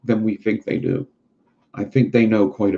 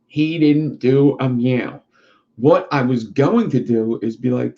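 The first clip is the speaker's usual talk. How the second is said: ordinary talk, nothing marked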